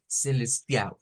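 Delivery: "celestial" with the final L sounded as a U. Shown as a demonstration. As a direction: say "celestial" ending in -iau. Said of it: "vamos a sonar una U".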